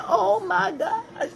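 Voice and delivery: high, slow gush with a laugh. Real, not acted. Fan gushing about a wrestler's dresses, high and laughing. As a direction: swoon over it with delight.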